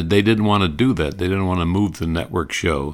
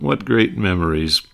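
usual speaking voice

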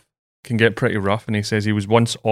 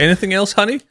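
high-pitched mock-eager husband; obliging husband; 9 semitones higher than usual. Husband owing his wife a kidney, mock-obliging. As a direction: overdo the meek eagerness, pitched well up.

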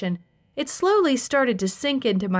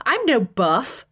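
higher, indignant denial; mildly angry protest; 4 semitones higher, slower than usual. A narrator's reading. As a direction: deny it hotly. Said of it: "he said angrily".

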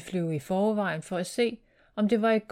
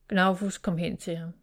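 plain reading voice

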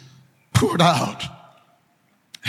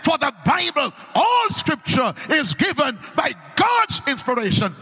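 preaching; 6 semitones higher than usual. Acted. Preacher proclaiming the word to the congregation. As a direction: call it out to the whole church.

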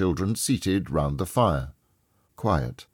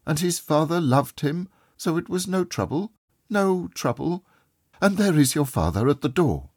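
much higher-pitched mother's voice; mother remembering her child; 10 semitones higher than usual. A narrator's reading. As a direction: lift the voice high for a tender mother. Voices mother talking of Tim.

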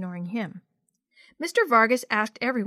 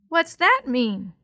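put-on slow, louder little-girl question; puzzled little sister; 3 semitones higher, 6 dB louder, slower than usual. A narrator's reading. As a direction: ask slowly like a puzzled small child.

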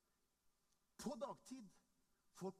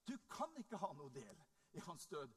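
ordinary talk, nothing marked

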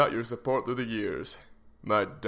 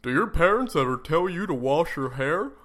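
walrus mascot voice; 3 semitones higher than usual. Acted. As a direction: pitch it like a goofy mascot.

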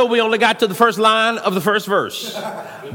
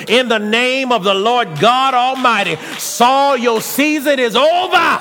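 shouted rebuke; 3 semitones higher than usual. Acted. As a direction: declare it with full force.

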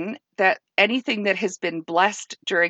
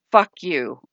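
determined; 2 semitones lower, 4 dB louder than usual. Retold or imitated. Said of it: "very, very determined".

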